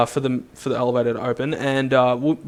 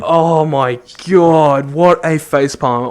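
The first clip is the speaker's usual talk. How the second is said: exasperated; 5 dB louder, slower than usual. Real, not acted. Reacting to the facepalm.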